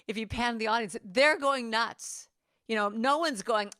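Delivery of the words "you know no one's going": ordinary talk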